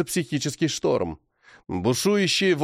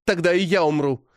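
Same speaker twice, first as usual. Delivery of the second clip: firm; 4 semitones higher than usual. A narrator's reading. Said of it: "твёрдо говорит Лиза".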